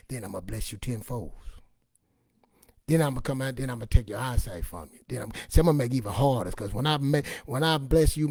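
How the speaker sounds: ordinary talk, nothing marked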